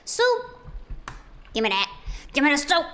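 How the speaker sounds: high, loud, playful demand; mock demanding shout. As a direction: holler for food like a hungry player. Mock demanding food in game.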